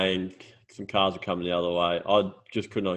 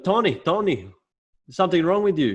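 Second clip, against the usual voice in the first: higher scolding voice; nagging neighbour lady; 9 semitones higher, 4 dB louder than usual. Retold or imitated. Retelling the neighbour lady scolding Tony, higher. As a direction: call his name like a fussing neighbour. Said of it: "She goes".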